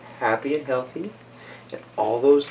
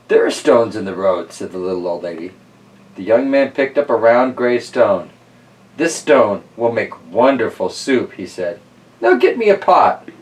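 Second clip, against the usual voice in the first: lively read-aloud storyteller voice; story narration; 4 dB louder than usual. Acted. Host reading Stone Soup to kids, storytelling voice. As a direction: tell it like a bedtime picture book. Reads picture book aloud.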